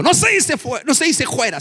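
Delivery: high, loud protesting devil; comic devil shouting back; the devil correcting. Acted. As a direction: squawk the correction in a high, indignant voice. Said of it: "Y el diablo le dijo".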